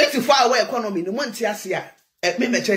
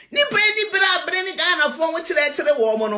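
sudden shout; 7 semitones higher than usual. Real, not acted. Ranting about Ghanaians, raised voice.

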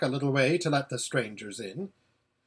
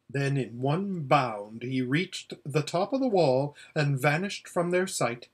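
ordinary delivery

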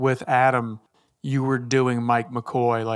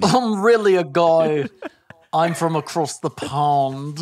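put-on over-the-top British accent; 3 semitones higher than usual. Friend mocks a British accent, hammed-up British accent. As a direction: play a cartoon Brit from across the pond. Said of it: "Just the most over-the-top British accent".

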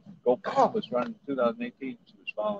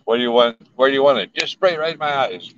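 loud mock exasperation; 2 semitones higher, 9 dB louder than usual. Joking about spray in eyes.

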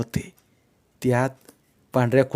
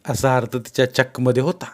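plain reading voice

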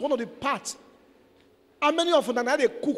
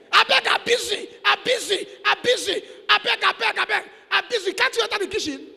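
higher, whiny excuse voice; mock lazy wife; 8 semitones higher than usual. Preacher mocking wife who refuses to cook, whiny. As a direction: whine like a wife dodging the kitchen.

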